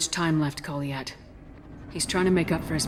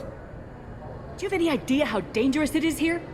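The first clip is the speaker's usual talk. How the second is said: faked alarm; 10 semitones higher than usual. Shepard fakes alarm to scare guard.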